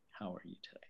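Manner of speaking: near-whispered, low greeting; hushed creepy greeting. Pretend. Greeting the camera as the creepy one, hushed. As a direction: drop to a playful creepy whisper.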